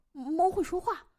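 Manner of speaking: put-on stunned boy seeing the impossible; startled amazement. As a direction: blurt it out in disbelief. Boy startled by talking cat.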